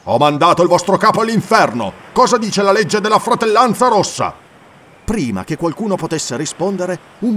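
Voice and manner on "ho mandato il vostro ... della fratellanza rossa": loud, higher, fierce warrior's roar; booming defiance, much louder; roaring challenge. Acted. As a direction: throw the question at the whole crew. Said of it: "ruggì".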